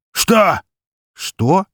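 high, loud double take; stunned. A narrator's reading. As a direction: blurt it out in disbelief. Both react stunned to dumplings request.

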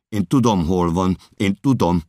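high, loud outburst; insistent shout. Acted. Narrator defying the official's order, insistent shout.

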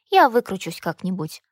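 usual speaking voice

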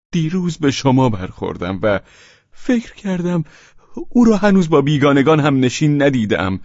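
flustered confession. Acted. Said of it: "پاول پاولویچ ناگهان آشفته شد".

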